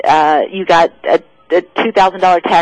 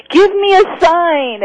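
high, drawn-out plea at ordinary volume; mock desperate scream; 11 semitones higher, slower than usual. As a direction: imitate crying up to God, stretching the words high. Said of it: "he's screaming up to God".

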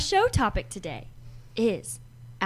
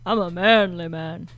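lower, slow mock macho voice; deep manly voice; 6 semitones lower than usual. Shown as a demonstration. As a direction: put on a jokey deep guy voice. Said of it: "Just go deep in your voice".

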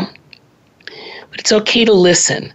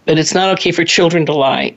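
ordinary talk

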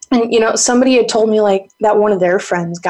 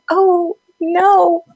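high, drawn-out lament; hammed-up regret, pitched high; mock dismay; 10 semitones higher, 4 dB louder, much slower than usual. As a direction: wail it in comic horror. Retold dismay at sniffed flower.

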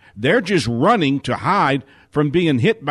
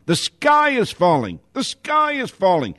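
mock fearful scream; 9 semitones higher than usual. Speaker voicing fear's warning that the sky is falling, mock fearful scream. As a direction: cry out a warning in pretend panic. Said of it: "of fear screaming".

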